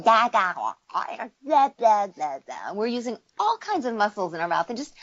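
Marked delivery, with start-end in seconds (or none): 0.0-2.7 put-on playful baby babble, pitch bouncing up and down; baby babbling. Shown as a demonstration. Coach demonstrating a baby playing with sounds, playful babble. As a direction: babble like an infant testing its mouth.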